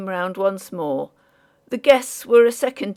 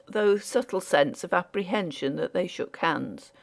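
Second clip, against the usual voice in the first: usual speaking voice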